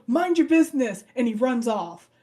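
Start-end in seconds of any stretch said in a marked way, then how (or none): 0.1-1.1 higher, louder little brush-off; a kid shooing off the adult; snippy kid. Retold or imitated. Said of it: "he was like".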